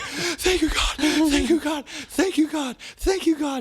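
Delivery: very high, frantic repeated thanks; overwhelmed relief. Retold or imitated. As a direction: gasp out thanks in hammed-up relief. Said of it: "I was just like".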